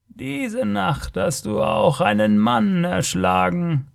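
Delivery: high, slow alien voice; knowing creature. A narrator's reading. Creature tells the barbarian of his killing tonight, eerily knowing. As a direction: stretch each word like an uncanny seer.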